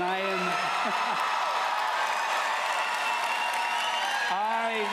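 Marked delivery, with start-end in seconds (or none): 0.4-4.2 evil cackle. Acted. Haunted Mansion villain laugh.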